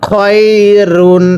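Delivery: খয়রুন stretched very slowly; slow model reading of খয়রুন; slow drawn-out reading. Shown as a demonstration. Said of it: "আবারও পড়বেন".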